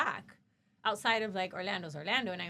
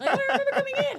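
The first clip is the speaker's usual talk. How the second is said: mocking through laughter; 12 dB louder, much faster than usual. Mocking imagined claim.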